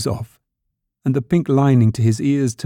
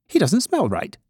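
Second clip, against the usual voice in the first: higher rabbit character voice; exclaiming wild rabbit; 8 semitones higher than usual. A narrator's reading. Wild rabbit sniffing toy rabbit, high exclamation. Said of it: "he exclaimed".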